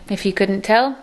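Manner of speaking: normal talking voice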